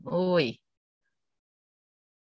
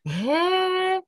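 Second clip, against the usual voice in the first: ordinary talk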